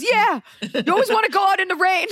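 high, emphatic nanny voice; teasing high accusation; bad Myrna impression. Nanny teasing her about loving rain, high and emphatic. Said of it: "this impression is so bad".

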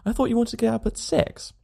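hoger gespeelde vriend, verwijtend; Harris grumbling. A narrator's reading. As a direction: klaagt verbaasd bij zijn vriend. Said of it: "He said".

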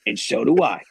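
high, slick lawyer pitch voice; fast-talking sleazy lawyer; Saul Goodman. Acted. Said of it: "Saul Goodman".

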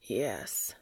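low whispered yes; breathless yes. A narrator's reading. Cass answering Dean in bed, whispered yes. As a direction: breathe the yes out, barely voiced. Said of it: "said Cass".

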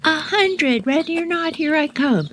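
high singsong call; calling out the hide and seek count; kid counting out. Retold or imitated. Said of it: "we could hear Sharon go".

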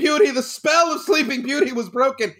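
high-pitched, hammed-up rapture; mock gushing. Teacher parodying raves for a middle school play, mock-ecstatic. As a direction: gush as if it were a triumph. Mocking an overblown rave review.

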